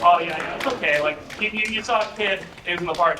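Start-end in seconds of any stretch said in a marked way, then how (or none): none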